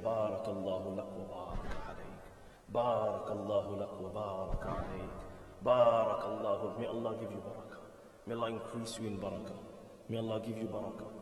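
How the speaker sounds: soft, low murmured blessing; hushed, low prayer over someone; gentle blessing. Retold or imitated. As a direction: bless him quietly and tenderly. Prophet's blessing, gently retold.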